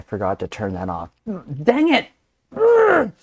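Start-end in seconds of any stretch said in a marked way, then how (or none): 1.6-2.1 high, exasperated exclamation; frustrated outburst. Real, not acted. Host forgot to turn it off, frustrated. Frustrated at own accidental clip. 2.5-3.1 high, loud exasperated groan; frustrated groan. Real, not acted. Host vexed at own mistake, groaning high.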